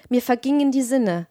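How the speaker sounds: ordinary delivery, nothing marked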